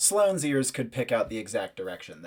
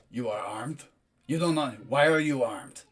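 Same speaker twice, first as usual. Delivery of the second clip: Matus, suspicious foreigner. Acted. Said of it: "you hear one of them".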